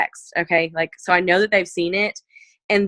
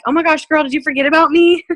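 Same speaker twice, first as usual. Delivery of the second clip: higher, louder, teasing and bright-eyed; playful teasing; 7 semitones higher, 4 dB louder than usual. Retold or imitated. Teasing a customer who skipped the promised post, playfully higher and louder. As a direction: tease her like a friend who forgot you. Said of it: "I'll just say something funny".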